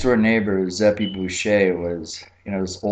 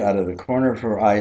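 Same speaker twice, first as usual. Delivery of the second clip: ordinary talk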